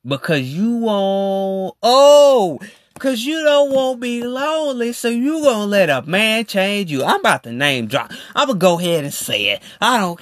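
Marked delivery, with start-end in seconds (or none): 1.8-7.0 mocking. Mocking weak women's reasoning.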